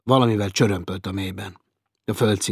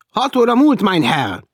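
much higher, loud servile call; lift boy; 13 semitones higher, 11 dB louder than usual. Acted. Lift boy answering the guest, high and loud. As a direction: sing out the time like an eager lift boy.

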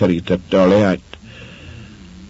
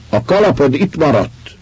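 higher call after someone leaving; 10 semitones higher, 3 dB louder than usual. A narrator's reading. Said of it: "kiáltott utána az asszony".

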